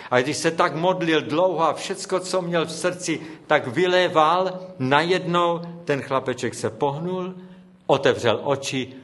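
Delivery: ordinary talk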